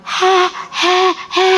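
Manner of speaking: a hoarse voice that keeps vanishing, high and thin; croaky sick voice. Shown as a demonstration. Teacher imitating a lost, croaking voice. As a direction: show the ladies a voice that drops out. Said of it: "bisa tahu nggak ibu-ibu gimana".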